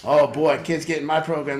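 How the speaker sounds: ordinary talk, nothing marked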